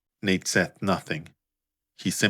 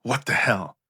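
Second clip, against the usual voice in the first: higher, shocked outburst; shocked rage; 5 semitones higher than usual. Acted. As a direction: snap it out in sudden rage.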